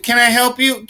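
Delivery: bright, high sales-clerk greeting; shop clerk. Retold or imitated. Clerk greets a nervous customer at the door, high and chirpy. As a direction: chirp it up like an eager store clerk. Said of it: "someone comes in".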